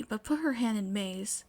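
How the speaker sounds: ordinary delivery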